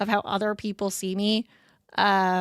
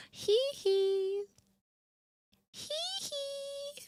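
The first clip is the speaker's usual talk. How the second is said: cute high giggle; 9 dB quieter, much slower than usual. Acted.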